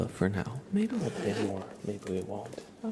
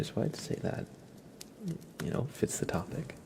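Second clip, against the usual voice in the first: stuffy whispering voice; blocked nose; 7 semitones higher, 9 dB quieter than usual. Real, not acted. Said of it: "I'm so freaking stuffy".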